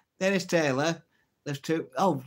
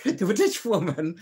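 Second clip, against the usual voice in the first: a light, higher Irish lilt; Irish accent; 5 semitones higher than usual. Shown as a demonstration. Restarting the joke for viewers in an Irish accent. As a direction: slip into an Irish accent like Jimmy Cricket. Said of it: "Why am I going Irish?".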